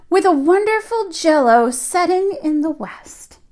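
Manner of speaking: put-on lively read-aloud voice for young children; storybook reading. A narrator's reading. Teacher reading a picture book to her class, lively storytelling. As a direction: tell the tale to the class as if they sit on the rug.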